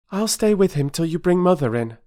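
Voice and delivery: put-on a brave girl offering help; young Bobby.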